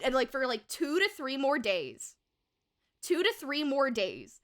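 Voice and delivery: usual speaking voice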